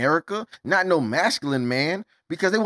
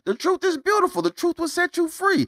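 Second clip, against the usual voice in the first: jumps way up high for these lines; a mock-sweet voice far above his own; sudden high voice; 12 semitones higher than usual. Preaching to viewers about the truth, in a sudden high voice.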